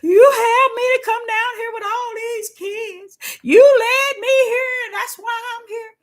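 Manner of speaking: high, loud mock sobbing wail; clowning at the hotel, loud theatrical crying; hammed-up crying. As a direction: overdo the tears to get a reaction. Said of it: "I remember clowning at the hotel".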